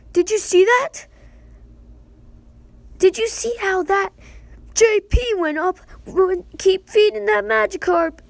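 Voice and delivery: high, loud put-on host voice; big animated game voice; excited game host. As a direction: exclaim it like a hyped-up tutorial character.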